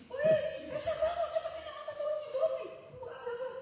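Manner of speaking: very high, urgent retold shouts; husband's panicked yelling. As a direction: yell like an alarmed husband rousing someone. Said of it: "suami saya teriak-teriak".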